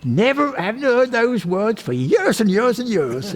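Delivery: put-on an old lady calling out in delight from the back. An old lady at the back of the talk hears dialect words, high, loud and thrilled. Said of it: "And an old lady at the back, she said".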